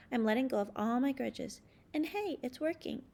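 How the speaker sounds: usual speaking voice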